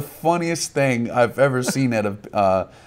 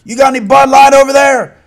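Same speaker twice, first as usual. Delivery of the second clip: loud, high call across the stands; one vendor hollering to another across the aisle; yelling across aisle; 13 dB louder than usual. Retold or imitated. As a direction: shout it over to the other vendor. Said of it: "was yelling across the aisle".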